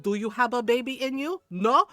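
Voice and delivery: put-on challenging questions in Filipino accent, loud on the last; imitated Filipino mom interrogation; full Filipino mom. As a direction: grill him like an indignant Filipino mom. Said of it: "she went full Filipino mom on him".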